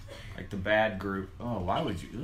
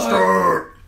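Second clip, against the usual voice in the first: loud, higher drawn-out yell; henchman's call; 8 semitones higher, 10 dB louder than usual. Retold or imitated. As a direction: imitate the second in command bellowing the name. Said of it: "the second in command".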